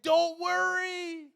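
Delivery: high, loud, drawn-out call; exaggerated reassurance. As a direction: stretch it out big and slow, hammed-up. Acts Jesus reassuring, exaggerated.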